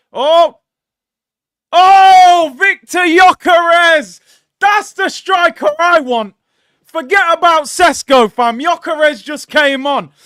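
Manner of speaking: real eruption, high and very loud; excited shouting. Real, not acted. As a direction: yell it like a fan at a goal. Erupts at striker he wants.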